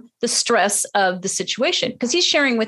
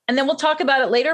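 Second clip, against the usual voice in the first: ordinary talk, nothing marked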